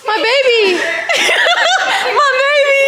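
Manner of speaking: high, hammed-up wail through laughter; mock-frantic cry. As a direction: cry out like a melodramatic mother, laughing. Mock-frantic mother calling child.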